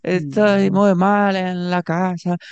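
high, slow pleading street vendor; imitating a street vendor begging on a first date; whiny vendor plea. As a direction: beg like a pitiful street vendor.

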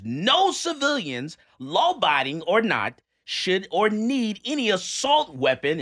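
high, loud, slow declamation; exaggerated, emphatic letter read; all-caps reading. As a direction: boom out each word as if written in capitals. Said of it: "In all caps, it says".

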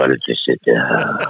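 very low crooning with laughs. Acted.

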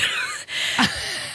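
short airy laugh, falling away; soft rueful laugh; breathy laugh. Real, not acted. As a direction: let a quiet laugh slip out at the absurdity. Laughs after the boss's blonde remark.

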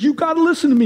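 high, urgent acted plea; desperate begging. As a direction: implore them to hear you out. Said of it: "You're desperate".

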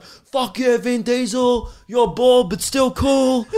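high, whooping imitation of a moviegoer; mock fan cheering. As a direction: cheer the action star in a put-on voice. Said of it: "you're supposed to be like".

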